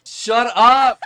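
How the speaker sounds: loud, high burst of amazement; disbelieving shout. Real, not acted. Shocked reaction to crime name reveal.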